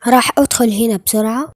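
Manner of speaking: normal talking voice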